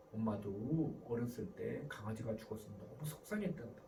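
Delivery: low, soft, tender parent voice; warm empathy. Acted. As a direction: gently share a child's sadness. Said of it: "마지막으로 마음주기 스타일이 있어". (아이 마음을 읽듯 낮고 다정하게)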